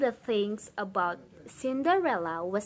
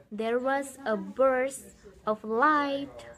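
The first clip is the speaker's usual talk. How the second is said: ordinary delivery, nothing marked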